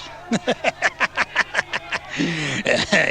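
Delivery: long, high demented laugh; maniacal laugh. A narrator's reading. Wolf chilling the listeners with a demented, maniacal laugh. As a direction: cackle on and on like a madman. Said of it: "maniacal laugh that chilled the listeners".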